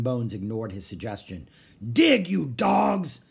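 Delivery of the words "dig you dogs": high, drawn-out bellow; slow, loud pirate command; shouted order. A narrator's reading. Captain to his digging crew, slow shouted order. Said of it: "he shouted".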